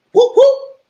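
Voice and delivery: high, loud double whoop; two punchy high sound-effect whoops; imitated whooping noise.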